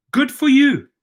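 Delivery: high, loud "good for you"; emphatic approval. Real, not acted. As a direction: burst up with hearty approval. Approving the protesters' rights.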